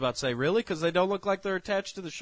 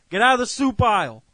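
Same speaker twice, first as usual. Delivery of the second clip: loud, higher exasperated order; exasperated caller; 7 semitones higher, 9 dB louder than usual. Acted. Caller to clothes shopper lost among soup, acted exasperation. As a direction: order them away in mock frustration.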